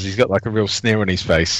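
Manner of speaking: normal talking voice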